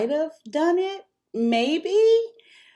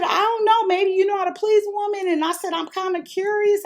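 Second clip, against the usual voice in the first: high, louder, teasing; playful tease; 13 semitones higher, 5 dB louder, faster than usual. Retold or imitated. To her friend about the husband, high teasing.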